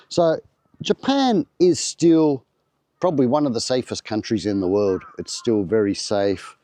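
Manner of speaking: usual speaking voice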